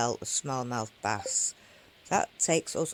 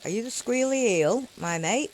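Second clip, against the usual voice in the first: excited coaxing; 8 semitones higher, 4 dB louder than usual. Real, not acted. Coaxing the eel on the line.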